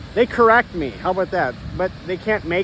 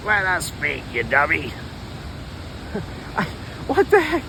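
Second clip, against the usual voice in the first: less femmy voice; 4 dB quieter than usual. Acted. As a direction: speak as the squatting voices, lightly. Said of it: "One that's not so, uh, femmy".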